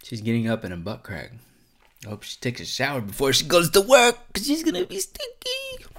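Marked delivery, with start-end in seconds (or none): 2.0-5.9 climbing into a very high squeal; hammed-up joke. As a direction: build louder, then squeak it out. Hammed-up joke about her text.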